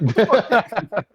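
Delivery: loud, high, drawn-out disbelief; acted high-pitched shock; incredulous outburst. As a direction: blurt it out high and loud, stretching the words. Acted stunned reaction to kiss.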